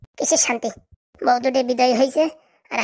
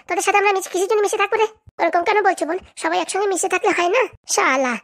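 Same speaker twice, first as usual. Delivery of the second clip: higher-pitched bickering women's voices trading insults; quarrelling village wives; 4 semitones higher than usual. Acted. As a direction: play two sharp-tongued wives in a spat.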